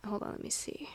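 whispered aside to herself; whispering. Real, not acted. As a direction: whisper it to yourself.

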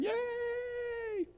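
high, stretched crowd cheer. Retold or imitated. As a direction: cheer like a whole army at once. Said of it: "all the people are cheering".

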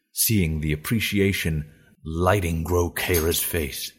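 ordinary delivery

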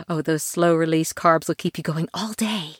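plain reading voice

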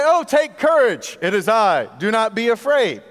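high, loud, hammed-up solemn line; mock formal reassurance. Preacher mocking a parent soothing a frightened child, hammed-up solemn. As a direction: ham up the stiff comfort to a scared kid.

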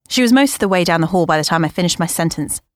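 ordinary delivery, nothing marked

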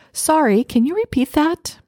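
high-pitched, polite asking to repeat; apologetic request. Acted. Listener who missed something asks for a repeat, apologetic.

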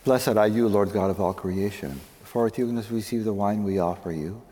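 ordinary talk

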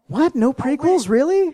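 high incredulous question; can't believe it; surprised. Real, not acted. Host surprised at pick.

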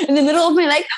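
high, breaking into laughter mid-phrase; laughing aloud. Real, not acted. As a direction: laugh through the words. Laughing while recalling excitement.

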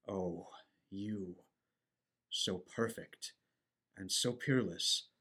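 softly adoring Miranda; tender adoration. Acted. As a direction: melt into the praise. Ferdinand's adoring praise of Miranda.

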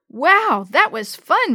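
high, loud thrill; bursting with excitement; excited exclamation. A narrator's reading. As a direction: exclaim it, thrilled after a wild ride. Child thrilled after the rapids.

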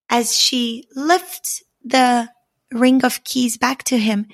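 plain reading voice

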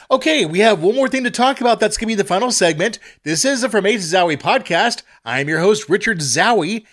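high, fast, upbeat announcer read; bright radio host sign-off, quick; podcast announcer. Acted.

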